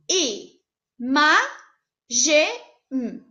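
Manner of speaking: "I, ma, ge, m" spaced out with a lone final m; syllable by syllable. Shown as a demonstration. Teacher testing a wrong split aloud, syllables spaced. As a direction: pull the word apart piece by piece. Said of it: "E o imagem, tá certo?".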